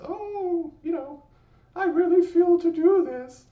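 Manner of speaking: very high, loud falsetto gush; squeaky high mock sentimental voice; mock emotional gush. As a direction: ham up a gushing inner feeling. Mocking own little emotions.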